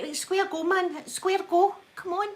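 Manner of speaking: high, hard Glasgow street challenge; Glasgow street accent. Shown as a demonstration. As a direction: call out a square go, Glasgow style. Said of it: "And I'd be like".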